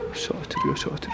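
hushed scared whisper. Pretend. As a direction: breathe it out in mock fear. Scared whisper in dark house.

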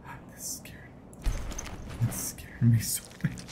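breathless, shaken whisper after a scare; spooked whisper. Real, not acted. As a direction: whisper it, still rattled from the scare. Player spooked by game scare.

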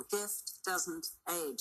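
slow and soft, stress on doesn't; slow emphasis. Shown as a demonstration. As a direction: let doesn't carry gift doesn't age, slowly.

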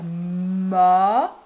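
"ma" very drawn out and loud, long M opening into A; insisted syllable sound. Shown as a demonstration. Dictating the syllable to write, "ma" stretched insistently. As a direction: hold the M of "ma", then swell on the vowel. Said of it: "n'hésitez pas à insister".

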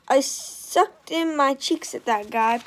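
ordinary delivery

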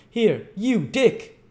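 high, loud summons; calling out. Acted. Missus Mann summoning Dick for the beadle, calling out. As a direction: holler for a child out of sight.

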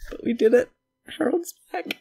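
high, loud happy cheer; gleeful squeal. Real, not acted. Signing off happy about Harold, high gleeful squeal. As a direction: squeak the win out.

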